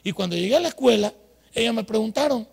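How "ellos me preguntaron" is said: ordinary delivery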